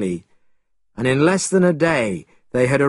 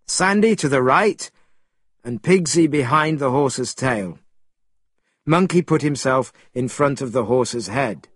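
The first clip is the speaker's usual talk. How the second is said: ordinary delivery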